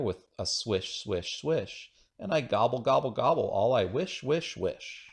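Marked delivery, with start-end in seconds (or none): none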